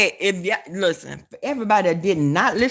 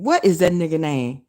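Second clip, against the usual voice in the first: normal talking voice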